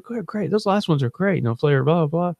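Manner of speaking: high-pitched mock gushing; imitated gushing customers. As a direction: gush in a put-on high voice. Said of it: "We're like".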